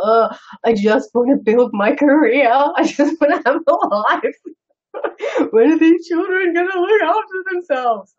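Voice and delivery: put-on a teenager's mother half-crying in exasperation; exasperated mum. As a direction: play the worn-out mum wailing at her lot. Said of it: "imagine, right, my mother having to deal with this teenager".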